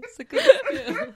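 high, slow, laughing; mock high praise. Shown as a demonstration. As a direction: stretch it out high and amused.

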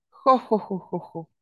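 booming "jo, jo, jo" laughter fading out; dragon laugh. A narrator's reading. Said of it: "rió el dragón".

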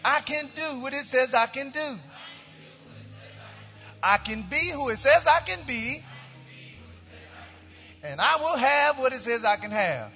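high, ringing chant-like declaration in short phrases; congregational declaration. Real, not acted. Pastor leading the church in its Bible confession, bold high proclamation. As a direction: proclaim it phrase by phrase for the crowd to repeat.

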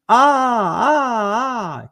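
loud, high drawn-out cry of "ah"; acted scream. Son hurt falling on the mountain, screaming. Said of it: "he screams".

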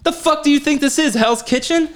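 hammed-up disbelief; mock outrage. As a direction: play offended at the doubter. Mock outrage at cooking doubts.

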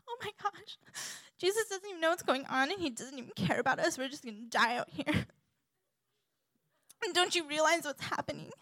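high, hurried, hammed-up alarm; panicky fretting. Acting panicking Matthew the tax collector.

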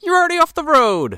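high, loud exasperated retort; mock indignant shout. Podcaster acting out the roadside argument, indignant mock shout. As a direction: yell it back in disbelief.

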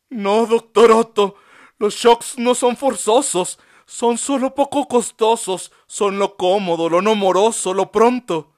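high, loud, passionate defiant monologue; impassioned speech. A narrator's reading. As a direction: plead your dignity loudly to the doctor. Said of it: "monologó con honroso modo".

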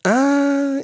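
long, very high "ah"; jester's exclamation. A narrator's reading. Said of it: "sagte der Spaßmacher". (langgezogenes, hohes Ah des Spaßmachers)

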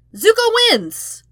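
very high, loud, slow announcement; triumphant call. A narrator's reading. The narrator crows Zuko's Flip Cup win, very high and loud. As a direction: crow the win like a game-show host.

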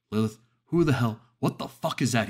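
loud, high, shocked scream. A narrator's reading. Brother bursts in and screams at the demon. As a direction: yell it at the thing in her room. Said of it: "he screamed".

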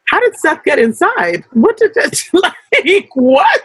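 high, shocked disbelief, laughing; incredulous. Retold or imitated. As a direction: act stunned that he just appeared. Incredulous reaction to Seth appearing.

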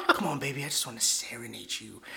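low, soft mock-seductive croon; hushed imitation of a smooth pimp; coaxing pimp. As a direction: sweet-talk her through the door. Pimp coaxing through door, retold.

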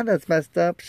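high, upset echo; high-pitched agreement. Retold or imitated. His echoed agreement with friend.

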